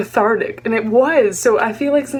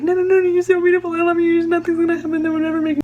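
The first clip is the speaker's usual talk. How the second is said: high, fast, gushing reassurance; frantic reassurance; 8 semitones higher, faster than usual. Retold or imitated. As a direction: rush to reassure him, overflowing. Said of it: "I wanna be like".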